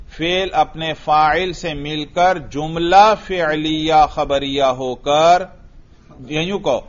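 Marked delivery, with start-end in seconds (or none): 0.1-5.4 slow, deliberate recitation of the correct parse; teacher slowly dictating the right form; slow model answer. Shown as a demonstration. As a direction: spell it out slowly for the student. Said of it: "ہاں ایسے نہیں".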